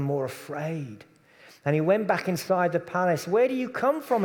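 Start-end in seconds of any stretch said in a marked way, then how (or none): 3.3-4.2 high, anxious questioning; a fearful governor pleading for an answer; Pilate asking. Acted. Pilate anxiously asks Jesus where he is from, higher pitched. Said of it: "he asked Jesus".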